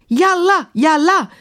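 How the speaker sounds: high, urgent shouts; shouted command. A narrator's reading. Emma ordering the flying carpet to move, shouting. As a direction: cry the command out high, twice over. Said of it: "schrie sie".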